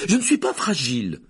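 put-on loud, indignant boy's outburst; angry exclamation. A narrator's reading. Boy objecting to being called fragile, angry. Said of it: "s'exclama Harry avec colère".

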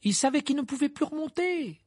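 strained high reply to be heard; shouting over noise. A narrator's reading. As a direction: call back over the din. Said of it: "en criant lui aussi pour couvrir le vacarme".

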